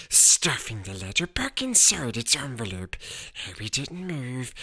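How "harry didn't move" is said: plain reading voice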